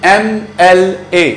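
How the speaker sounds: "एमएलए" slowly spelled out, em, el, ay; letter by letter. Shown as a demonstration. Teacher asking learners to look at the word, "एमएलए" slow, letter by letter. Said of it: "दोस्तों, इस word को देखें".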